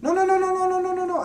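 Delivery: loud, very high string of mock protests; insistent street vendor. Market vendor protesting to a haggling shopper, imitated insistent refusal. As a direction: imitate a pushy seller refusing, all in one burst. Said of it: "It might seem aggressive".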